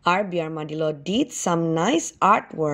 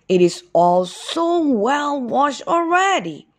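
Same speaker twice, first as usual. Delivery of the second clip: papa armadillo voice; 8 semitones higher, 4 dB louder, slower than usual. A narrator's reading.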